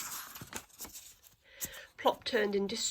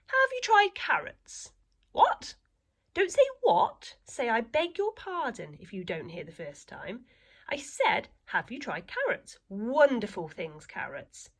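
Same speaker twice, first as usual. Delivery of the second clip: put-on high, slow, prim old lady; a prim elderly lady offering advice, much higher and slower; fussy old lady; 4 semitones higher, 4 dB louder than usual. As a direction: speak like a fussy old grandmother.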